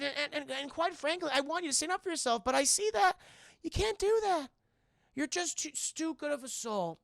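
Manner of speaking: a hint of a doting mother turned avenger; lightly voiced mother.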